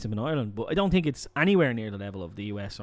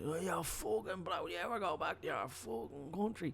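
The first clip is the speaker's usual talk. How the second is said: out-of-his-box addict; 6 semitones higher, 11 dB quieter than usual. Retold or imitated. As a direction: imitate a man off his head, high-pitched and hushed. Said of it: "he was out of his box".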